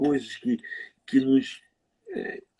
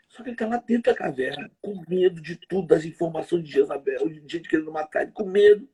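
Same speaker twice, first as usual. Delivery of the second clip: usual speaking voice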